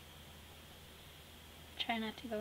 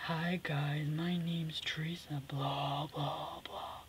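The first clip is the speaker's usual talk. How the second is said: lower, slow, hushed dull intro; mock boring Teresa; 5 semitones lower, much slower than usual. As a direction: parody her usual tame self, slow and low. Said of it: "I took over Teresa's boring spot".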